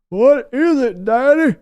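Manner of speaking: high, loud put-on son's voice; Steven answering. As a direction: answer Daddy like a cowed grown son. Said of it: "yelling at Steven".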